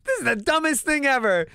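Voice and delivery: put-on high, exasperated teen complaint; indignant whine. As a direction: throw up your hands at the unfairness. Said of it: "So we were like".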